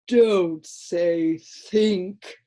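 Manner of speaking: slow, drowsy creature drawl; a dull little creature explaining to Milo, slow and sleepy; sleepy Lithargian. A narrator's reading. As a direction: drone it lazily like a half-asleep creature. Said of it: "said one".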